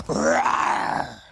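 low, loud breathy roar; lion roar. Acted. Lion roars at the giant, low and loud. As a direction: roar like a king of the jungle.

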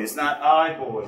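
put-on "I boy", opening on a long I; wrong pronunciation demo. Shown as a demonstration. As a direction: slowly sound out "I boy" as a mistake. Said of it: "It's a bye".

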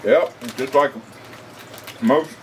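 speaking between bites; talking while chewing. Real, not acted. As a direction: mouth partly full of chips. Seen 0: Man ate a chip at -4, jaw still working while talking.

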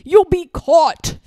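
loud, high cry of panic; alarmed gasp. A narrator's reading. Lord Droon to himself, about to be caught, alarmed. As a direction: warn yourself urgently, voice jumping up. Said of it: "gasped Lord Droon".

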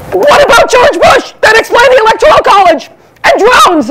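high, loud demanding voice; hammed-up accusing questions; mock indignant. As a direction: challenge them like an outraged Canadian. Canadians grilling an American.

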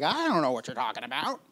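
mock-clueless disciple voice; hammed-up confusion; Thomas. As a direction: put on a comically baffled follower. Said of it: "And Thomas is like".

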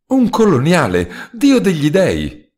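high, loud, slow delighted outcry; excited exclamation. Acted. Host hears his guest is a colonial, loud excited cry. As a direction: shout it up in astonished joy. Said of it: "esclamò il mio interlocutore".

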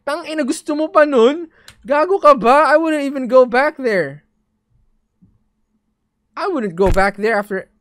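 loud, high incredulous outburst; scared horror game streamer. Real, not acted. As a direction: shout in disbelief at the screen. Outraged reaction to game text.

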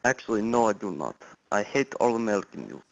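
lightly put-on Russian accent, stiff and formal; a hint of a fake Russian caller; weak mock Russian accent; bad Russian accent. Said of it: "That is the worst accent I think I've ever heard".

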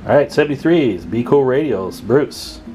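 ordinary talk

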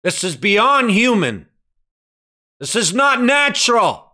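high, impassioned pulpit cry; forceful preaching. Real, not acted. Preacher on Jesus who never cried out, forceful preaching.

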